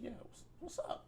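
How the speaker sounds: retold near whisper; soft, breathy wary reply; hushed wariness. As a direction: whisper it uncertainly, stumbling on the question. Retold uneasy reply to brother.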